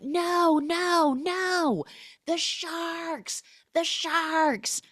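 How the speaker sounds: very high mock panic; shrieking warning about sharks; panicked plea. Cam begs Meg not to swim, very high panic.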